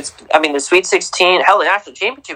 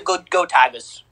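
Coach O; 5 semitones higher than usual. Acted. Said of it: "slowly to morph into, uh, Coach O".

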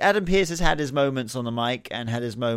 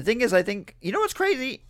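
voice cracking; 11 semitones higher than usual. Real, not acted. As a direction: voice suddenly jumps way up and cracks. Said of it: "Wow, my voice went crazy then".

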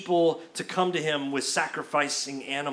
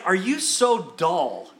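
louder, slow mock disbelief; exasperated; 3 semitones higher, 6 dB louder, slower than usual. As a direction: scold them with drawn-out exasperation. Acted exasperated Jesus scolding disciples.

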